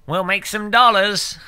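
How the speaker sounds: over-the-top fake American accent; mock American accent. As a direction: show off a cartoonish American voice. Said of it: "that's a hundred percent American accent".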